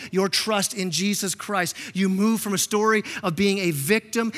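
ordinary talk, nothing marked

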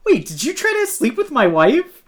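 high, loud incredulous question; mock shocked husband. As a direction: imitate a husband's outraged disbelief.

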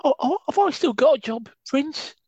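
high-pitched mock Cockney wide boy. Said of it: "my first attempt at the higher version of Betis".

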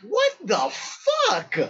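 high-pitched, drawn-out disbelief; stretched, astonished exclamation; stunned disbelief. Retold or imitated. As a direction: re-enact being floored, pitch jumping up. Stunned as woman walks past.

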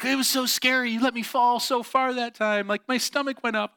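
high, hammed-up thrilled girl; sisters acting it up. Sisters hyping the trust fall to lure their brother, acting it up. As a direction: gush in a put-on high voice. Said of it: "they're really acting it up".

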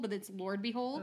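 normal talking voice

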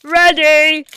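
"Ready" called out loud and high to the editor; loud booth ready call. Shown as a demonstration. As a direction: sing out "Ready" so the booth hears it. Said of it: "And I'll go".

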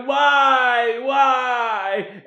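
a hammed-up distraught wail; mock anguished cry. Johnny holding the red dress, hammed-up anguished wail. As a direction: cry out to the heavens in mock despair. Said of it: "he's like".

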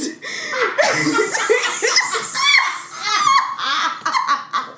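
long high shrieking laugh; shrieking laughter. Real, not acted. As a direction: lose it completely laughing. Both crack up at the punch.

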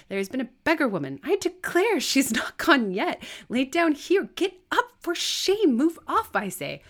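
very high, loud indignation; outraged scolding. Acted. Servant ordering the beggar to get up, outraged. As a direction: shout them off the doorstep, scandalised.

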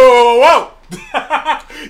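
slow, drawn-out laughing whoa; mock Avengers. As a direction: stretch each whoa out, amused. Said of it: "Avengers said".

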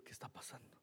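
susurrando perplejo, sin voz; whisper. Retold or imitated. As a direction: se pregunta en voz baja qué pasa. Puzzled, searching on YouTube. (murmullo sin voz, desconcertado)

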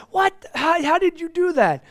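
high-pitched, astonished outburst; amazed. Retold or imitated. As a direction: squeak out your disbelief. Amazed reaction to the mechanic.

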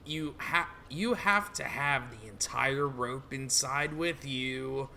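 low, very soft menace; soft-spoken villain. Acted. Villain lets intruder inside the hole, low and soft.

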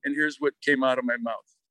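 ordinary talk, nothing marked

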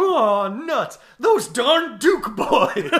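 high, loud, slow hammy villain grumble through a laugh; cartoon villain. Mocking the villain's defeat, high loud hammy grumble. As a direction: ham up a foiled villain. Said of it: "and he's just like".

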